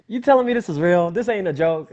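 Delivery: loud, high-pitched incredulous outburst; can't believe the good news, voice jumping up; excited disbelief. Retold or imitated. Fan hearing he'll meet his idol, excited disbelief. Retold disbelief at getting tickets.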